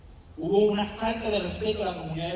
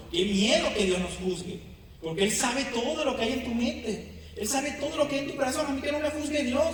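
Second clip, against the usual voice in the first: high, fast impassioned preaching; preaching at full force; 8 semitones higher than usual. Acted. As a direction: raise the pitch and preach it hard. Preaching builds to high pitch.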